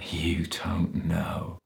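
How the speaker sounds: slow, whispery mocking echo; hushed, contemptuous drawl; sneering. A narrator's reading. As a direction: sneer it back softly, drawing out each word. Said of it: "Stainsforth sneered".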